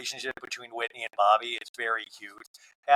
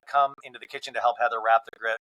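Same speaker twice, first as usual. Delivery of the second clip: ordinary delivery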